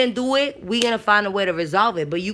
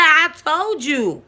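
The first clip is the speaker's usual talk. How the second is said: high, slow I told you; hammed-up I told you so; 6 semitones higher, 4 dB louder, much slower than usual. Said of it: "I was like".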